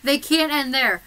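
loud panicked protest; frantic protest. Retold or imitated. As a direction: shout it in a panic. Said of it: "I was literally saying".

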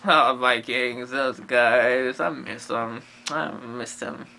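low, fading nostalgic sigh; mock wistful. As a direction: sink low and soft, missing old friends. Pretend nostalgia for Vikings.

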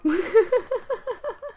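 loud high-pitched laughing fit; high burst of laughter. Real, not acted. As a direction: crack up at the scandal. Continues laughing.

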